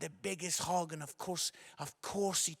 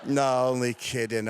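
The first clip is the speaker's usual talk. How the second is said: usual speaking voice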